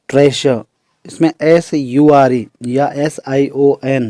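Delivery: ordinary talk, nothing marked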